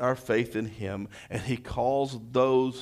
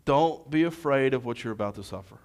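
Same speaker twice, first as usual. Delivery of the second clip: ordinary delivery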